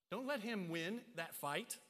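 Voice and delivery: ordinary talk, nothing marked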